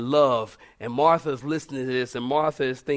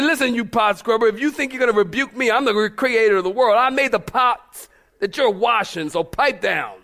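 mock scolding; 5 semitones higher, 6 dB louder than usual. Imagined Jesus scolding Martha.

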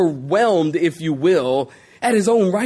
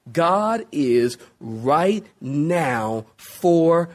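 drawn out; 5 semitones lower, much slower than usual. Shown as a demonstration.

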